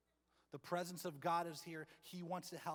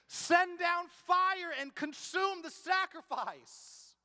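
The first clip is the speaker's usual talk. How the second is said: slow, stretched, much higher and louder wail; desperate begging cry; 13 semitones higher, 13 dB louder, much slower than usual. Retold or imitated. False prophets begging their god for fire, loud wail. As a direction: cry it up to the sky, pleading. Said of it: "they're begging and pleading".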